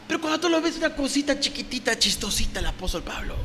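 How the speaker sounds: mock-cute put-down; belittling mockery. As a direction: make him sound tiny and laughable. Belittles Paul as critics did.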